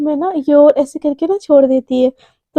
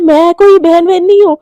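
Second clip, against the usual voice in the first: indignant; 4 semitones higher, 7 dB louder than usual. Acted. Indignant retort to senior.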